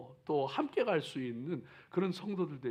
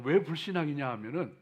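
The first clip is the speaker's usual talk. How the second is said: normal talking voice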